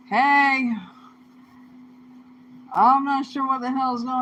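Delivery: sudden loud call to someone away. Real, not acted. Vlogger calling out to someone nearby, loud call. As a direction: shout it out across the room.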